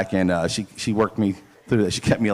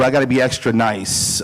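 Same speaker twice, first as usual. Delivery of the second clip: ordinary delivery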